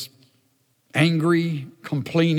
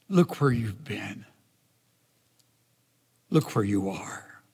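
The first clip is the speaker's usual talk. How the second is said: tender whisper; 5 semitones lower, 3 dB quieter than usual. Acted. God whispering to sulking Jonah, tender and hushed. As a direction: coax him quietly in a whisper.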